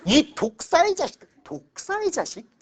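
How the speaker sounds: high, loud, slow mock insult; 독사의 자식 hurled high and slow; mock curse. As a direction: spit out 독사의 자식 like a scornful preacher. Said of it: "이게 예수가 제일 잘하는 욕이야".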